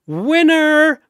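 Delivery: usual speaking voice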